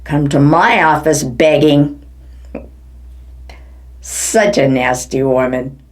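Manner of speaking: usual speaking voice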